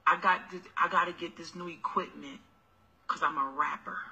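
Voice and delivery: low, soft sweet-talking hustler voice; mock broke boyfriend. Mocking a broke boyfriend asking.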